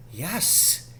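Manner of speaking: hushed yes; quietly. A narrator's reading. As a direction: say it quietly and sure. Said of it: "he said quietly".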